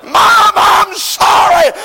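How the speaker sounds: slow, loud, high wailing apology; a preacher voicing sinners crying out from hell; hollering out. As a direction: holler it out slow to mom and dad. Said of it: "And they keep hollering out".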